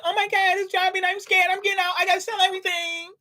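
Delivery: high, fast panicky voice; panicked investor. Retold or imitated. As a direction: squeal it like a scared seller.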